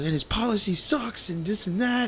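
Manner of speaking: high, stretched mock tirade; imitated screaming rant. Retelling brother trashing the policy, mock rant. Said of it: "screaming the top of his lungs".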